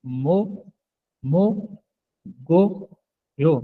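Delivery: मो, मो, गो, यो, each syllable set apart. Shown as a demonstration. Teacher splits मोगोयो into syllables for the class. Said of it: "तो ये पढ़ा जाएगा मोगोयो".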